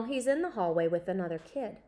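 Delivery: ordinary delivery, nothing marked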